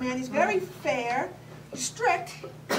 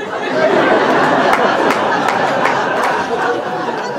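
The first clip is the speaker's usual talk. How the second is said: loud, theatrical wailing sob; hammed-up sob; 6 semitones higher, 14 dB louder than usual. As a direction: let out a big comic sob. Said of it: "I didn't mean to get emotional".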